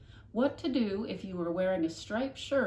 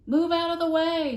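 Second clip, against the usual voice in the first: loud, high bossy order; girl ordering neighbour aside; commanding shout; 11 semitones higher, 13 dB louder than usual. Acted. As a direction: shove past with a command. Flora pushes neighbour aside.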